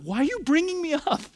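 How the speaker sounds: high-pitched mock complaint; imagined whiny protest, voice leaping high; dismayed protest. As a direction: pitch it way up, as if bewildered at being singled out. Imagining Job's dismayed thought to himself.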